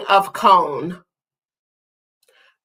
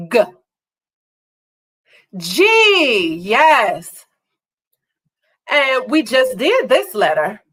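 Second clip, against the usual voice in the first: ordinary talk, nothing marked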